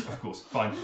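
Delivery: normal talking voice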